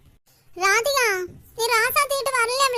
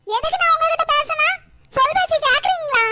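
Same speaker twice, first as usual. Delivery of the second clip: a grandmother's sharp, louder scolding voice; cross grandmother; 6 semitones higher, 3 dB louder than usual. Acted. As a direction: snap at the grandkids who never listen. Grandmother snapping back at kids. (கோபமான பாட்டி குரல்)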